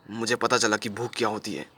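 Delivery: low, soft, pained admission; subdued confession. Acted. Acted confession of learning hunger. (धीमी, दबी आवाज़ में इक़रार)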